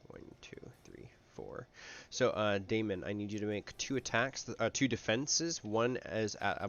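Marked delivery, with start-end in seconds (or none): 0.1-1.7 whispering. Shown as a demonstration. Counting fades to whisper.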